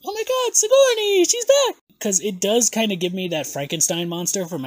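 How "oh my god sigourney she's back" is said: much higher, louder gasp of delight; excited squeal. Retold or imitated. Host retells first reaction to the look with a squeal. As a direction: shriek with excitement. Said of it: "my first thought was".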